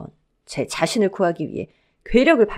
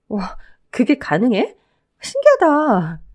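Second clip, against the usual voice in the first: slow, drawn-out marvelling, rising high; amazed wonder; 4 semitones higher, 4 dB louder, slower than usual. Acted. Wife hears husband's shark survival trick, amazed wonder. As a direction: marvel at it, stretching the words.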